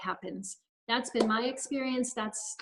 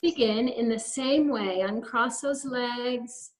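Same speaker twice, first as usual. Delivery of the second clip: guided meditation. Acted. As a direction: lead them gently through each breath, unhurried. Leads a guided breathing practice.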